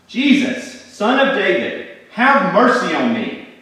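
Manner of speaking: loud, high, pleading shout; loud cry. A narrator's reading. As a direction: cry out over a crowd for mercy. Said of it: "I cried out in a loud voice".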